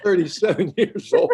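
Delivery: words spilling out through laughter; laughing. Real, not acted. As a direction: laugh while you say it. Laughing at his own story.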